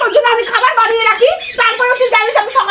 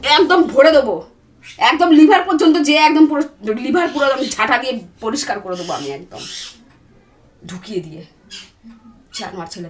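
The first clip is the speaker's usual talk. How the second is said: lower, sinking into a dark muttered threat; low seething threat; 9 semitones lower, 3 dB quieter than usual. Real, not acted. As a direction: growl it out, menace dropping away to almost nothing. Wife threatens husband in quarrel.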